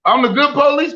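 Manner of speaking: disbelieving I'm the good police; indignant disbelief. Real, not acted. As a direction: repeat it back, offended. Indignant surprised question.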